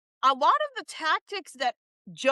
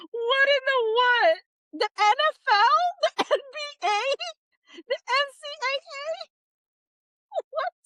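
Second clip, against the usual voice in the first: gasping at each name; laughing disbelief; 8 semitones higher than usual. Real, not acted. Streamer reading sports leagues off the list, high and amazed. Listing absurd defendants, laughing.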